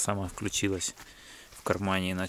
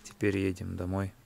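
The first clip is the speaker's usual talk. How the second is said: normal talking voice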